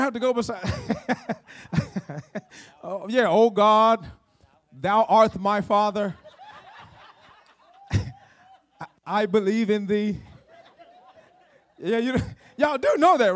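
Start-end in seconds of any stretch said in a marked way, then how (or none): 3.3-10.2 slow mock old-English prayer; renaissance fair olde speech. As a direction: ham up a thee-and-thou prayer. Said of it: "talk in old English".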